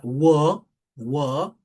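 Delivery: "wo" with a long held open O; lengthened vowel. Shown as a demonstration. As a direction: stretch the vowel in "wo". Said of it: "denotan alargamiento".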